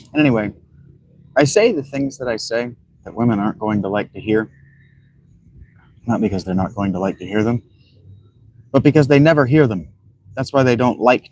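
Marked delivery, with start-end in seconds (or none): none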